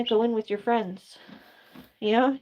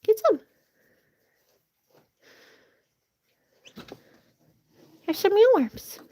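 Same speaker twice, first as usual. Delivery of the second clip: much higher coaxing voice for the birds; 10 semitones higher than usual. Real, not acted. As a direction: coax the hens up high and sweet. Baby talk to chickens.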